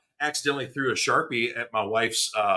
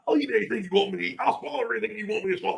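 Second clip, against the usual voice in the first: silly put-on voice; 7 semitones higher, much faster than usual. Joking innuendo in funny voice.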